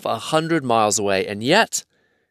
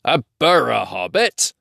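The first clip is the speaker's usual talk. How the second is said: mildly startled troll question; puzzled troll echo, rising at the end; a bit startled; 3 dB louder than usual. A narrator's reading. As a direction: repeat the strange word, taken aback. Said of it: "said they, a bit startled".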